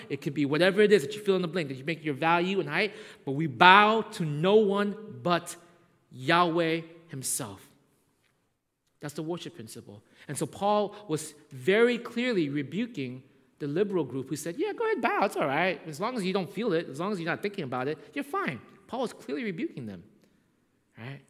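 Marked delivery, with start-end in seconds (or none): 3.2-7.6 louder, very slow, weighty; slow emphatic preaching. Acted. Pastor declaring the principle to the church, slow and weighty. 14.6-18.6 mocking. Mocking the liberal group.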